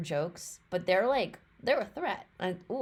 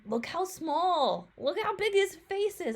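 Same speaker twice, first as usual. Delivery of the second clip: cooing delight; 11 semitones higher, 4 dB louder than usual. Real, not acted. Cooing at tiny turtles.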